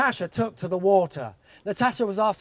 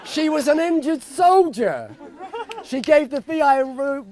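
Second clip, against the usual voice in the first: excited; 5 semitones higher, 4 dB louder than usual. Real, not acted. Excited punchline in speech.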